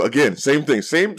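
ordinary delivery

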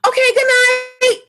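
loud, high-pitched mock goodbye; high cheery call. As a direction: sing out a sudden loud farewell. Tipsy guest's abrupt cheery goodbye.

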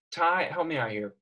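ordinary delivery

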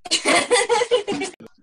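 loud real laughter. Real, not acted. Reader loses her place, laughs out loud. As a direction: laugh after losing your place.

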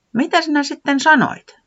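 high owl voice; Pöllis asking. Acted. Pöllis asking Tiputi, high and curious. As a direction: ask curiously.

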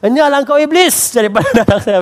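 high, loud joking rebuke of the devil; mock exorcism. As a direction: cast out the devil in fun. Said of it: "Daddy, like".

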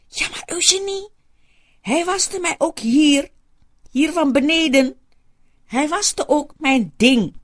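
hoog en langzaam, geschokt; verontwaardigde oude vrouw. Acted. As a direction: spreek het woord man vol afschuw uit. Soetie's embarrassed complaint. (hoge, trage, verontwaardigde oudere dame)

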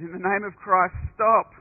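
a last cry from the ground; dying plea. Retold or imitated. Wounded monk pleading with the crowd, weaker loud cry.

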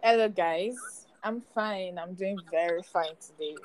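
ordinary delivery

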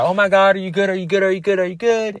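imitating worried friends. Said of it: "And everybody's like".

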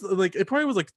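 ordinary talk, nothing marked

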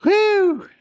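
high loud laughing whew. Real, not acted. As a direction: blow out a whew after laughing. Laughing sound after joke.

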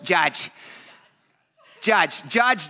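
put-on "Judge" for George, Boston accent with the R dropped. Imitating the church electrician's name, Boston accent on "Judge". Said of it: "hearing somebody from Boston".

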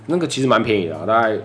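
ordinary talk

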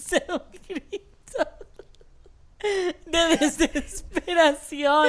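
piercing high scream of despair, stretched slow; desperate scream. Retold or imitated. Remembering the vinegar burn, screams in despair. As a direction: let out a wailing scream of despair. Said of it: "yo grité así".

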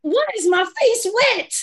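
high, loud, alarmed self-questioning; shocked disbelief. Acted. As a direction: protest in high-pitched bewilderment at your own tears. Acts out sudden tears mid-meeting.

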